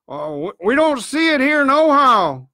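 high, loud defensive reply; called-back answer. A narrator's reading. Man upstairs answers the blind man, calling loudly. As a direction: call it back down to him. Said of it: "returned the man".

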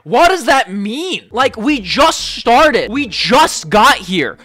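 slow, high, loud disbelief; drawn-out, stunned what; baffled disbelief. Real, not acted. Reacting to creepy DM.

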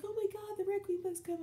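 high-pitched fangirl squeal; breathless high fan squeal; squealing excitement. Retold or imitated. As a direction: imitate a friend gushing over a new book. Friend Michelle squealing excitedly.

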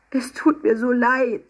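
high, slow, miserable plea; distressed apology. A narrator's reading. Emma begs Mama's forgiveness, distressed. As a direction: apologize close to tears.

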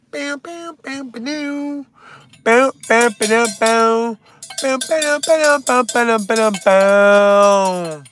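high imitated electric guitar licks; vocal guitar riff. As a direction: sing the riff building to a loud held note.

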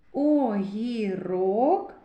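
Огірок drawn out slowly with each syllable spaced; slow sounding out. Shown as a demonstration. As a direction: stretch Огірок so kids catch each sound. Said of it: "Гі м'який приголосний".

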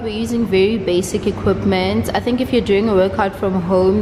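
normal talking voice

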